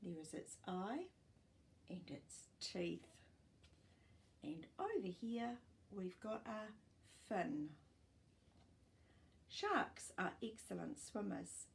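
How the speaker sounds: ordinary delivery, nothing marked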